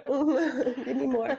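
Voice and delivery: slow, laughing request. Real, not acted. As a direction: drag it out, laughing. Joking while laughing.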